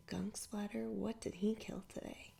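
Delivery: ordinary delivery, nothing marked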